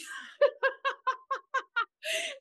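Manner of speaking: high-pitched giggle; high breathless giggling; high laugh. Real, not acted. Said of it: "It's so funny".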